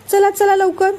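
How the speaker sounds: high-pitched call to get moving; urgent hurrying. A narrator's reading. Wife hurries husband along.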